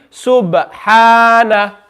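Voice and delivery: slow Subhana, bounced b, long ha; model qalqala reading. Shown as a demonstration. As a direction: echo the b in Subhana for pupils. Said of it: "say it after me".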